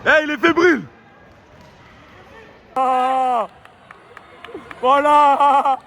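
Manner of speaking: high, loud ringside yells; excited shouting. Real, not acted. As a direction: yell it over the crowd. Cheering a fight from ringside.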